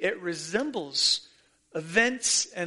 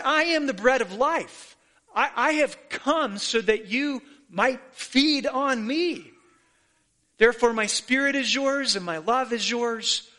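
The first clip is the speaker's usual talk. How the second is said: fervent preaching; 4 semitones higher than usual. Acted. Voicing Jesus, preaching fervently.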